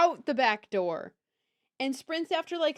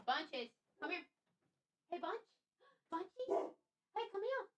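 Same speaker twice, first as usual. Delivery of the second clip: soft pet voice; 4 semitones higher, 10 dB quieter, faster than usual. Real, not acted. Coaxing the pet.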